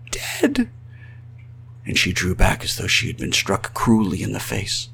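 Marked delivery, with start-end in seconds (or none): 0.1-0.7 soft, high, horrified whisper; tiny high-pitched whisper. A narrator's reading. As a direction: echo it in a stunned hush. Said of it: "she whispered".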